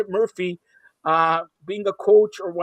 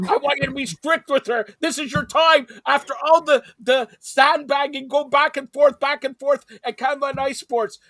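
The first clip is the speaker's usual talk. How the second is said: higher-pitched, urgent motivational push; rallying exhortation, pitched up; fired-up pep talk; 7 semitones higher than usual. Real, not acted. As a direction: egg them on like a hype man. Hyping Taylor to coach Digit.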